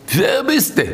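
high-pitched, sharp mock demand; incredulous challenge. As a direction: demand it like you never met him. Father acted denying his son. (א הויכע, פארוואונדערטע פראגע)

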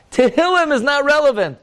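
very high-pitched exclamation; insistent high proclamation; emphatic declaration. Real, not acted. Repeating the provocative conclusion emphatically.

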